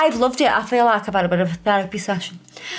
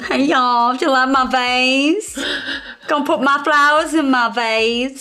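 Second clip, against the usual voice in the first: put-on Southern US accent; 3 semitones higher than usual. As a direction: put on a playful Southern belle twang. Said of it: "I think that's more southern".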